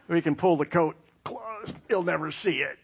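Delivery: usual speaking voice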